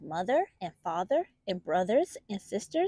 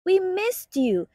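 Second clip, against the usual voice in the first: high, slow, louder little voice; littlest bunny; 9 semitones higher, 4 dB louder, slower than usual. Acted. Littlest bunny greets Titu coming home, high and slow. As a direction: draw it out like a tiny bunny glad he's home. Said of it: "the littlest bunny said".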